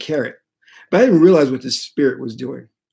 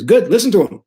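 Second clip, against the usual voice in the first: high, loud imitation of a priest; emphatic priestly approval, raised pitch; high priest's voice; 7 semitones higher, 5 dB louder, faster than usual. High priest voiced giving advice.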